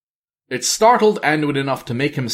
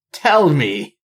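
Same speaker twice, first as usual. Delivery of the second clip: sing-song mocking; 5 semitones higher, 4 dB louder than usual. A narrator's reading. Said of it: "she mocked in a sing-song voice".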